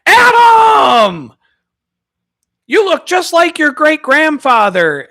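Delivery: put-on high shouted name, slow loud old lady delight; high loud elderly lady greeting; excited old lady. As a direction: call out his name like a thrilled grandma. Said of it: "And then I'd walk in".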